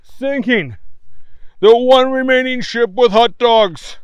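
loud grave newsman report; news reader. A narrator's reading. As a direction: deliver bad news like an anchor. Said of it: "said the newsman".

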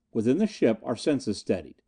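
ordinary delivery, nothing marked

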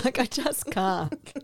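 laughing through the words; cracking up high. Real, not acted. Laughing after impression.

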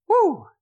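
high-pitched "woo" cheer; one quick, delighted squeal of approval; excited whoop. Real, not acted. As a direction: let out a short, high whoop. Cheers after the reading.

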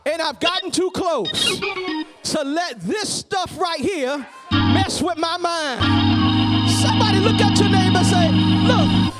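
building from steady to shouting climax; rising preacher cadence. Acted. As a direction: climb to a full-force preached peak. Sermon climbing to a climax.

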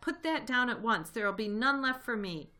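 high, loud scolding rat; shrieked. A narrator's reading. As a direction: order them off in a shriek. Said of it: "shrieked Rat".

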